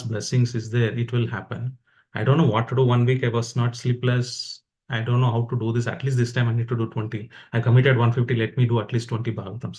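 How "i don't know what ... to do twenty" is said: ordinary talk, nothing marked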